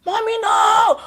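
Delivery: very high desperate scream; son screaming. Retold or imitated. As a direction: scream it to stop the attack. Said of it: "my son just scream".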